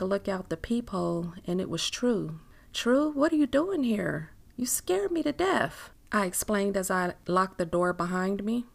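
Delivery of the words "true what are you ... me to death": high-pitched startled exclamation. Acted. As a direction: exclaim at an unexpected visitor. Startled by a late visitor.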